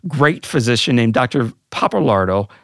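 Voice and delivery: normal talking voice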